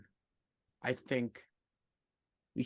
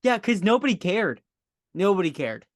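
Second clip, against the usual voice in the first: louder, higher retort; 8 semitones higher, 9 dB louder than usual. Real, not acted. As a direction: fire back, raising voice. Heated playful argument.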